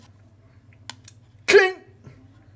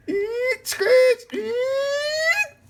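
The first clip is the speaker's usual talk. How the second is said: panicked yell; 11 semitones higher, much slower than usual. Real, not acted. Frantic in-game struggle.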